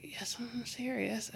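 slow hushed whisper; slow, whispered reply. Retold or imitated. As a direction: whisper it back, slowly. Hushed answer to teammate.

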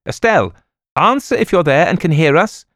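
frantic hostess calling for the lost guest, loud. Acted. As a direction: call through the walls for the missing girl. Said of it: "Ada Hardress called continually".

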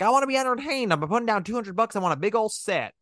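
high-pitched mocking Southern accent. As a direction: play a dumb tourist with a Southern accent. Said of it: "I don't mean to do the Southern accent".

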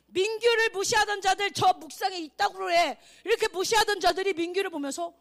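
loud, high, driving proclamation; full-force preaching. Real, not acted. (크고 높게 힘껏 외치는 설교)

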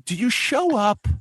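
high, slow, hammed-up question; imagined TV host. Co-host voicing the show's host answering her, mock-dramatic. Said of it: "that's when I wanted Chris to go".

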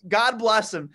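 ordinary talk, nothing marked

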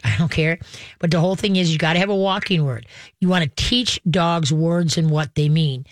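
ordinary talk, nothing marked

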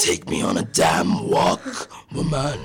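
low, loud demon bark; the dog bellows an order; shouting demon dog. Retold or imitated.

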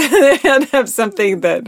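laughing. Real, not acted. Laughing about the light comedy.

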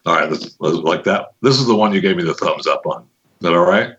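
ordinary talk

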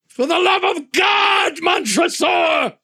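desperate cry. Acted. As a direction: scream it out in terror. Fortunato's final desperate cry.

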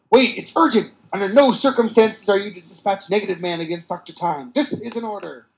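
urgent, loud radio command; urgent order. Acted. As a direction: bark orders over the radio. Chief over radio.

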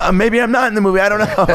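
high-pitched, flustered retreat; nervous backtracking. Retold or imitated. Man backing down before a barking dog, high and nervous.

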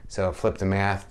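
ordinary talk, nothing marked

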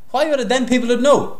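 high loud protest. Real, not acted. Defensive outburst.

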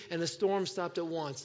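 ordinary delivery

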